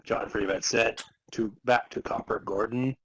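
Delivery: ordinary delivery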